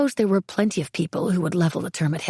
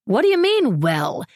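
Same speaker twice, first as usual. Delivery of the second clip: incredulous challenge; 6 semitones higher, 4 dB louder than usual. A narrator's reading. Margot challenging Beverly's vague answer, incredulous. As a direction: pitch leaps high, loud disbelief.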